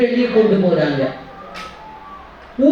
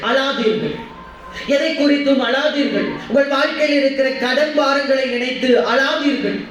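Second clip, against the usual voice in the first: a slightly raised, rhythmic preaching refrain; preaching exhortation; 3 semitones higher than usual. Acted. Pastor urging the grieving congregation not to weep, preaching refrain. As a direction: plead with the congregation, each line ending on a fall.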